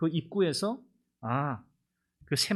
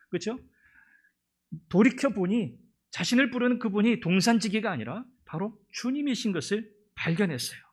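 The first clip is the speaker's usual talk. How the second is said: ordinary talk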